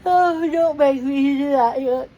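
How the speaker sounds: talking through a yawn; slow, stretched yawning words. Real, not acted. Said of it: "I didn't yawn".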